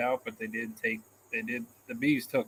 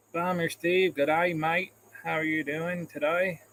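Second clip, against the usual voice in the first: put-on Australian accent, pitched up; amateur imitation of an Australian accent; 4 semitones higher than usual. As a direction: greet like a hammy Aussie, higher-voiced. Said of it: "That's my Australian accent for you".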